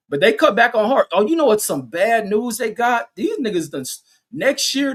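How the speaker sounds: high-pitched, worked-up complaint; indignant rant. Real, not acted. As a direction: rant in disbelief. Indignant rant about school start time.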